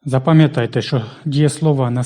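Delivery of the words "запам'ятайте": normal talking voice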